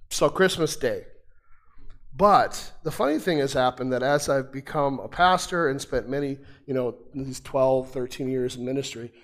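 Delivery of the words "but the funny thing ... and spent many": ordinary delivery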